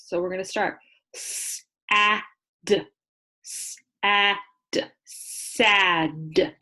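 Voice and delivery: s, a, d said separately, then blended into sad; sounding out. Shown as a demonstration. Teacher tapping out sad for her class. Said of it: "I like to tap out with my fingers".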